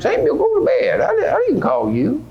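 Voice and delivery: put-on half-asleep old man shooing the boy back to bed; old Eli. Eli answers the boy Samuel.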